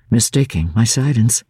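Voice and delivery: ordinary delivery, nothing marked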